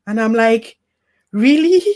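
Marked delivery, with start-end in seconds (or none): 1.3-1.8 indignant disbelief. Retold or imitated. Indignant reply to tactless comfort.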